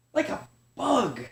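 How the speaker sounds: high, small cub voice echoing; high little echo. Acted. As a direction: echo it back like a tiny cub. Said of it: "echoed Ryan".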